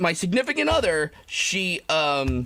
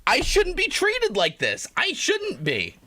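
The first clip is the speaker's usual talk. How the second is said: high whiny complaint; 6 semitones higher than usual. Real, not acted. Self-pitying complaint.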